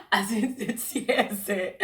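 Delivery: high teary laugh, slowly; laughing through tears. Real, not acted. Recalling tears at the airport, laughing high. Laughing over nearly crying.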